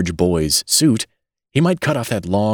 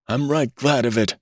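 higher, lightly voiced old man whining; old man's whine; 6 semitones higher than usual. A narrator's reading. Old newborn grumbling about the hospital, light whine. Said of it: "whined the old man".

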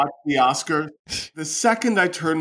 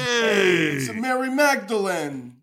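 a light put-on New York-style greeting, higher; mock accent; 7 semitones higher than usual. Cohost greets Mary Mags in a lightly put-on accent. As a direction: greet her in a hammed-up tough-guy accent. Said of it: "Why are we doing accents?".

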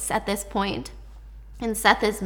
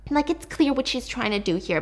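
ordinary delivery

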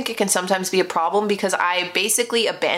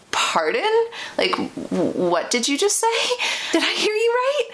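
high-pitched, disbelieving retort; stunned pardon, rising sharply at the end; incredulous; 12 semitones higher than usual. Retold or imitated. Woman challenges date's grotesque comment, incredulous. Retelling her shocked reaction to comment.

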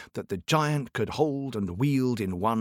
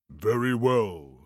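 giant voice; slower than usual. Acted. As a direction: rumble it out like a giant. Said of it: "giant voice".

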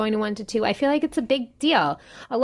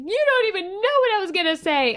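put-on upset little boy; 10 semitones higher than usual. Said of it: "he was like".

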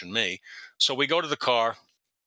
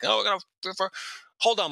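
high-pitched imitation of a broken-English caller; imitated angry caller, broken English, voice pitched up; broken-English cursing; 9 semitones higher than usual. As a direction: sputter a threat in mock broken English. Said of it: "cursing at me in half-broken English".

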